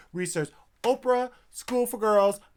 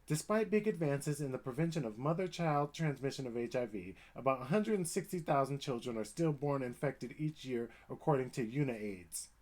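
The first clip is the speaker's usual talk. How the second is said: reading aloud; 4 semitones lower, 7 dB quieter than usual. Real, not acted. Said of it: "reading article aloud again".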